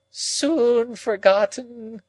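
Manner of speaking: high, very slow moan. A narrator's reading. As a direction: draw the words out in a grieving moan. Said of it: "moaned Miss Havisham".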